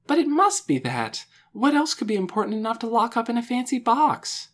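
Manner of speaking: high, excited protest; eager insistence. A narrator's reading. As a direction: argue it urgently, pitched high. Lena eagerly argues her theory.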